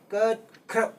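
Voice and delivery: ꯀꯠꯈ꯭ꯔꯕ broken apart with a pause after the first syllable; two-syllable split. Shown as a demonstration. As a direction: separate the syllables of ꯀꯠꯈ꯭ꯔꯕ slowly. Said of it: "ꯈꯣꯟꯊꯣꯛ ꯑꯅꯤꯗ ꯂꯣꯏꯁꯤꯟꯈꯤꯕ ꯌꯥꯏ". (ꯀꯠꯈ꯭ꯔꯕ ꯈꯣꯟꯊꯣꯛ ꯇꯣꯉꯥꯟꯅ ꯍꯥꯏꯕ)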